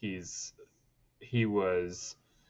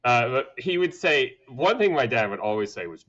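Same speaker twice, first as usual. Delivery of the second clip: ordinary talk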